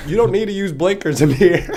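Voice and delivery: high, loud and amused; hammed-up loud correction; mock scolding local. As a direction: imitate a local setting a rookie straight. Local scolding driver, retold.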